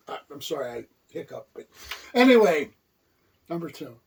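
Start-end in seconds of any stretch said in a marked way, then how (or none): none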